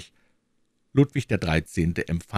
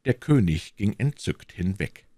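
ordinary delivery

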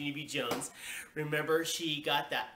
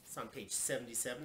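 usual speaking voice

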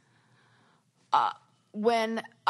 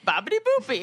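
put-on much higher and louder, bouncy Italian accent on "Babidi Boopy"; mock Italian accent; 13 semitones higher, 5 dB louder, faster than usual. Friend laughing at a restaurant name, hammed-up Italian accent. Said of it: "a joke of an Italian accent".

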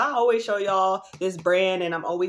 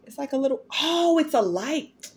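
delighted discovery; 6 semitones higher than usual. Real, not acted.

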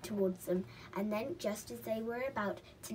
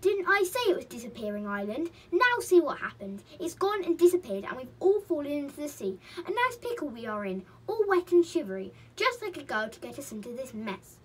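loud, raised-pitch telling-off; angry; 5 semitones higher, 9 dB louder than usual. A narrator's reading. Chinky blames Molly for the soaking, angrily. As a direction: scold indignantly, storybook style. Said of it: "said Chinky angrily".

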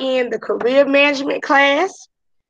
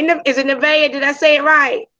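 ordinary talk, nothing marked

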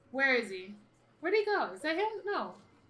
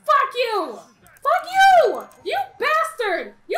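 high, loud yelling in real rage; furious shout; 9 semitones higher, 10 dB louder, slower than usual. Real, not acted. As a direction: scream curses at the enemy. Cursing enemy after failing.

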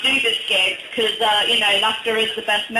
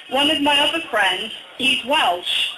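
usual speaking voice

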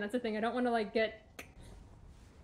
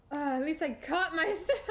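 laughing it out with relief; high relieved laugh through the words; 4 semitones higher than usual. Real, not acted. Streamer relieved she caught herself, laughing.

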